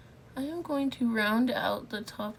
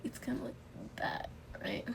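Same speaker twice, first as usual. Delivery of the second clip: whispering; 10 dB quieter, faster than usual. Real, not acted. Makeup vlog whispered aside.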